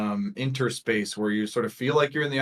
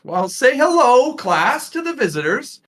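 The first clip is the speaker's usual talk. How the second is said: put-on singsong instruction to a roomful of children; Miss Kate; 10 dB louder than usual. Teacher prompting her class to greet visitors, loud singsong. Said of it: "Miss Kate waves, uh, and says".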